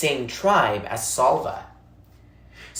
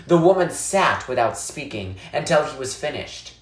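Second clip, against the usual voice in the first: ordinary delivery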